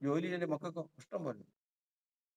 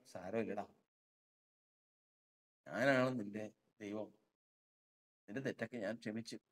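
soft, lower comforting voice; God consoling; 6 semitones lower, 5 dB quieter than usual. Acted. Preacher voices God consoling the soul, softly. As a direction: tell him gently it's all right.

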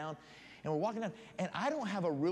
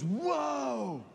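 high, drawn-out awestruck whoa; amazed outcry; 6 semitones higher, 4 dB louder, much slower than usual. Retold or imitated. Buddy seeing the giant dead bloated cow, loud amazement. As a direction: gawk loudly at something enormous.

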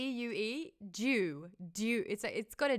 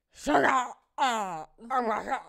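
loud, higher, breathless film heroine; Laura Dern impression; 3 semitones higher, 9 dB louder than usual. She acts a Jurassic Park heroine at a party, loud. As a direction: gasp like a movie heroine. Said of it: "be like".

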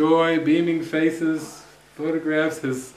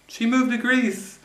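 playful reveal; 10 semitones higher, slower than usual. Retold or imitated.

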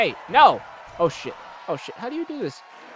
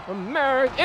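America said quicker and higher; a brisk, higher America; perky America; 7 semitones higher than usual. Pretend. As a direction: pop America up higher and brighter. Savoring his character's country.

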